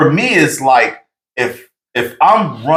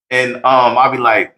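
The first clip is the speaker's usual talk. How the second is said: ordinary talk, nothing marked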